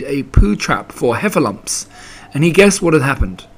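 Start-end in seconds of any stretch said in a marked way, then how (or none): none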